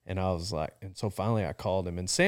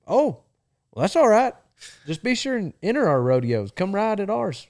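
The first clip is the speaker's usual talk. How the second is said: imitating easygoing boss; 11 semitones higher, 6 dB louder than usual. Boss answers kindly on phone.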